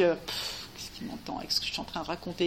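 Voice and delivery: chuchoté, rapide, découragé; murmure-le comme un doute intime; chuchotement découragé. Retold or imitated. Doute intérieur imité, chuchoté. (pensée chuchotée, pleine de doute)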